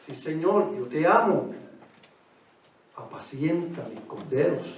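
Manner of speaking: soft, low voice for Peter's answer; hushed dialogue. Acted. Preacher voicing Peter answering the Lord, soft and low. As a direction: say it almost under the breath.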